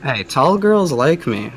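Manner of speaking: ordinary delivery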